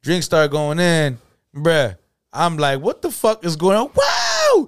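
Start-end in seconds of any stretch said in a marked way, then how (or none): 2.8-4.7 high disbelief ending in a shouted wow; pitched-up mock shock; mock astonishment. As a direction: act stunned then yelp. Reacting to drinking ladies.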